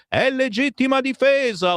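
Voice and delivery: high-pitched mock outcry; mock yell. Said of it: "urlano insieme la Meloni e Salvini".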